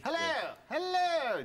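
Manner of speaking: high, drawn-out posh RP greeting; hammed-up received pronunciation. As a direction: call it out high in exaggerated RP. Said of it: "that was received".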